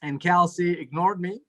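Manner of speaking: ordinary delivery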